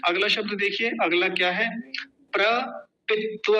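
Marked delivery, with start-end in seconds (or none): 2.3-3.5 प्रपित्व slowly as pra, pit-va; broken-up pronunciation. Shown as a demonstration. Teacher splitting a word for learners, slow separated pronunciation. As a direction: pause after pra in प्रपित्व. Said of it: "अलग अलग कर लीजिए".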